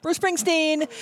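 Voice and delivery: high, proud announcing of a name; showing off. Retold or imitated. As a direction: flash it like a backstage pass.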